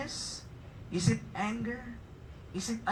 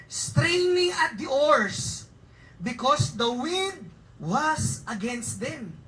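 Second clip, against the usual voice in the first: very slow, louder reading; scripture reading; 3 semitones higher, 5 dB louder, much slower than usual. Acted. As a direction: stretch out the phrase.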